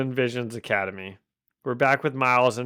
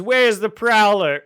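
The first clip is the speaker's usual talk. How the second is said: higher, louder villain demand; comic-book villain voice; 8 semitones higher, 7 dB louder than usual. Acted. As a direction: play a menacing thug loudly. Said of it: "they all talk like that in this scene".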